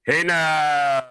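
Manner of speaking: high loud hey now; Stern catchphrase. Retold or imitated. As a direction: call it out like a show bit. Catchphrase as greeting.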